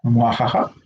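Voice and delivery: low villainous cackle; evil laugh. Pretend. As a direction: mwahaha like a mustache-twirling villain. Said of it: "un buen momento para una risa malvada".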